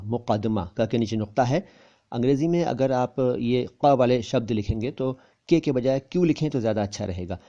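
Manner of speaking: ordinary talk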